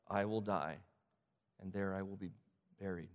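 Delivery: measured pledge, each answer dropping low; solemn vow. Acted. Daughter-in-law vowing to stay with Naomi, solemn.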